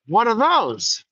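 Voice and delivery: high, pointed question; Jude Law's gunslinger. Retold or imitated. Gunslinger challenging the albino kid, high. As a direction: ask it with a calm dare. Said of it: "and he says".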